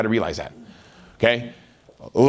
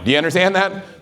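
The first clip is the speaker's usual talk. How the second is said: ordinary delivery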